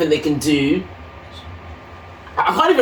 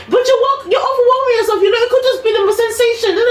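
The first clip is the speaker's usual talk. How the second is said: high, mocking impatient nurse; imitating the irritated night nurse, pitched way up. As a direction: mimic the nurse as shrill-pitched and dismissive, much higher. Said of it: "nurse mocked again".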